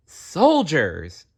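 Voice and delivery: put-on high, eager call of a boy; excited shout. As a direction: shout it out thrilled. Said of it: "Jerem shouted excitedly".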